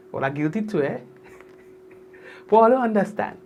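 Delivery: normal talking voice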